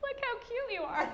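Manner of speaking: put-on very high baby-talk coo; hammed-up squeaky gushing; smitten cooing. Smitten newcomer gushing at her new colleague, high mock coo. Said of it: "I think I was just like".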